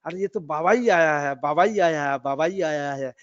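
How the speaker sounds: loud excited cry, retold; retold joyful shout. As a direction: call it out loudly in wonder. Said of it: "चिल्ला के बोलने लग गया". (ख़ुशी से ज़ोर से चिल्लाकर)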